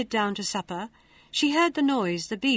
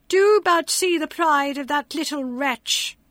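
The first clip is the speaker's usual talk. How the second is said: higher, louder, spiteful sisters; 5 semitones higher, 5 dB louder than usual. A narrator's reading. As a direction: sneer at her, shrill with spite. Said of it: "said all manner of ill-natured things".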